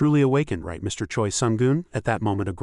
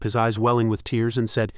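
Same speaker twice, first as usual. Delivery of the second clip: plain reading voice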